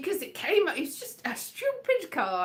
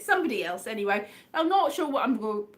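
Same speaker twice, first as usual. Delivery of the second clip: normal talking voice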